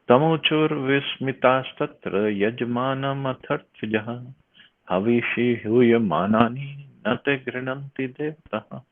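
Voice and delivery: measured, chanted reading of a verse; Sanskrit verse recitation. Acted. Teacher recites the verse to the class, chanting. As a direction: intone the verse like scripture.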